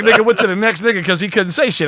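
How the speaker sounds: ordinary talk, nothing marked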